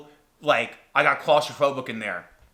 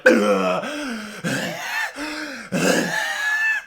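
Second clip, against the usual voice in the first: acted gasping; 10 semitones higher than usual. Tourist climbing out of the tunnel, gasping. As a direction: gasp desperately for air. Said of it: "I am just gasping for air".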